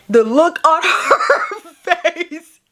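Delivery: slow, high, laughing words; real laughter stretching the words; cracking up. Real, not acted. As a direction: break into laughter mid-phrase. Cracks up at the woman's face.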